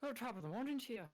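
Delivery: put-on high, loud, hammy Irish accent. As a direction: lay the Irish brogue on thick. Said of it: "I can do an Irish accent better than British".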